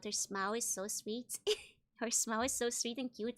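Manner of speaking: usual speaking voice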